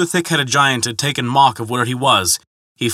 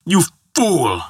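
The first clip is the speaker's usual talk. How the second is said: restrained bellow, pitched up; 6 semitones higher than usual. A narrator's reading. Giant rounds on his boastful visitor, bellowing. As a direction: hurl it as a narrated giant's insult. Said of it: "bellowed Hrungnir".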